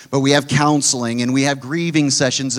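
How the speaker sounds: normal talking voice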